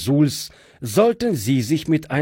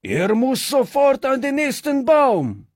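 angry bandit; 12 semitones higher than usual. Acted. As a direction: demand the rope at the top of the voice. Bandits demand hanging.

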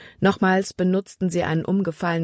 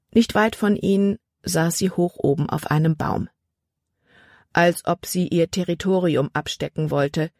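ordinary delivery

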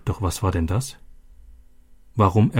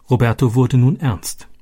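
plain reading voice

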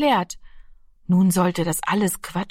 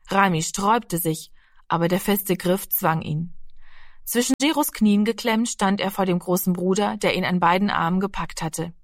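ordinary delivery, nothing marked

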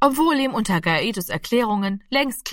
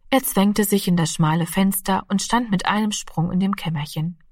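ordinary delivery

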